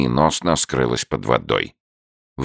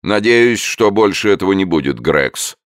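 higher, louder stern warning; 7 semitones higher, 4 dB louder than usual. A narrator's reading. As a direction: lay down the law. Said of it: "строго сказал сэр Чарльз".

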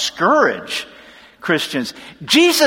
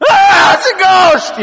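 put-on very high, loud childish shriek of fright; terrified shriek; 10 semitones higher, 7 dB louder than usual. Grown disciples spot a ghost on the water, shrieking high. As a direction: yelp it like a grown man crying like a kid. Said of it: "crying like kids".

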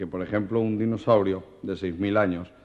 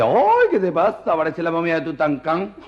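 camp shocked exclamation; 6 semitones higher, 6 dB louder than usual. Acted. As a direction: shriek in camp alarm at the sight. Friend shocked by plaster cast.

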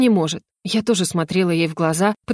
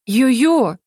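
higher-pitched indignant rebuke; 4 semitones higher, 3 dB louder, slower than usual. A narrator's reading. Girl protesting the hedgehog's high-handedness, indignant. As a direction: call him out, voice raised in pitch. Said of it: "возмутилась я".

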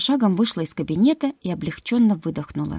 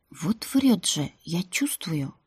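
slow unvoiced whisper; whispered aside; 4 dB quieter, slower than usual. Heroine whispers her suspicion about the guardian. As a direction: mutter the suspicion under your breath.

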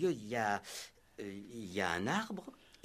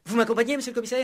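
much higher and louder, hopeful; excited high question; eager question; 14 semitones higher, 12 dB louder, much faster than usual. Acted. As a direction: ask brightly, eager to please. Inspector asks eagerly.

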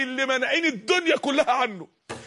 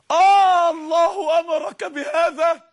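slow, high, loud sobbing question; tearful plea; 5 semitones higher, 5 dB louder, much slower than usual. Acted. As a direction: beg through tears as Hagar. Hagar pleading with Abraham.

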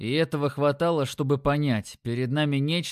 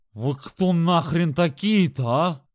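squeezed out; 3 semitones higher, 4 dB louder, slower than usual. A narrator's reading. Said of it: "побелел как мел и выдавил".

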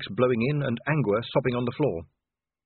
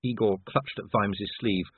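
plain reading voice

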